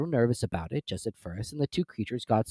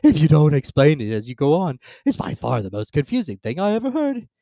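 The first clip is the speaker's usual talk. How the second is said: loud, odd-toned grumble; exasperated creature; a loud, strange turtle complaint; complaining Mock Turtle; 4 semitones higher, 8 dB louder than usual. A narrator's reading.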